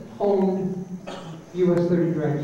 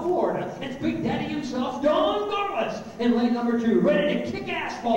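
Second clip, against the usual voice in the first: higher, building Sunday Sunday Sunday announcer hype; drag race radio ad; 7 semitones higher than usual. Shown as a demonstration. Replaying his old drag strip commercial for the crowd, hyped announcer. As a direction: sell the drag race like a barker. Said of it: "I will do it in what we call a dry voice".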